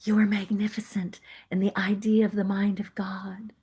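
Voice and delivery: soft breathy whisper, almost no voice; gentle whispered affirmation. Retold or imitated. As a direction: breathe it like a secret blessing. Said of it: "they maybe whispered".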